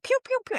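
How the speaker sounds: very high squeaky pew pew pew; the body cheering as it laughs; playful pew sounds. Retold or imitated. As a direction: squeak like happy body chemicals. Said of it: "your body's like".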